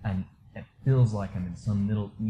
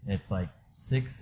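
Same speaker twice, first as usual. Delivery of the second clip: ordinary talk, nothing marked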